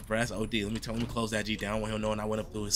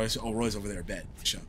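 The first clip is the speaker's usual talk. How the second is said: normal talking voice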